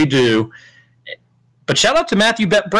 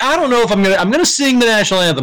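mock hesitant whine; 6 semitones higher, much faster than usual. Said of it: "you'll be like".

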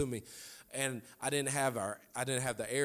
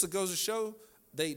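normal talking voice

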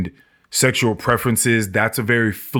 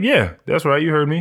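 higher, smug and sure; cocky confirmation; 5 semitones higher than usual. Retold or imitated. The drunk answers Dream's question, cocky and higher. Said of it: "he was just like".

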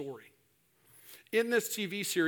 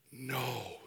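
low, soft shocked disbelief; hushed, acted horror; 5 semitones lower, 7 dB quieter than usual. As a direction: let it out quietly as dread sinks in. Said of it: "this shock of like".

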